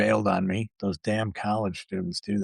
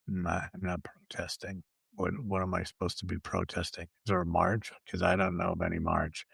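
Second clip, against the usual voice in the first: much softer, lower, innocent shrug; deadpan denial; 3 semitones lower, 7 dB quieter than usual. Retold or imitated. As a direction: play dumb quietly, lower and hushed. Customer plays dumb to clerk.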